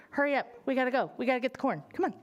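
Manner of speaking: usual speaking voice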